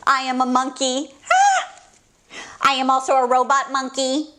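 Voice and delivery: ordinary delivery, nothing marked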